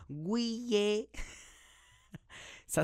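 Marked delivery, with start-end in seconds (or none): 0.1-1.1 put-on "Guillet" for juillet, in mother's accent, higher and drawn out; mispronounced juillet. Mother failing at the word juillet, says "Guillet". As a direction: stretch out a foreign-accented "Guillet". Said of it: "je suis pas capable de dire le mot juillet".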